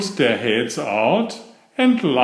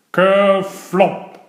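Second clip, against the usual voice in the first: splash sound; 6 semitones higher, 4 dB louder than usual. A narrator's reading. Narrating the trout's splash.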